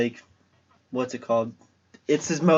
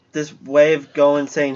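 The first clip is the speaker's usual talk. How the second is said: ordinary talk, nothing marked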